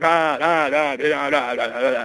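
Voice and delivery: loud high gasping ah ah; dying gasps. Retold or imitated. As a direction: gasp out a last breath. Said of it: "And then he said".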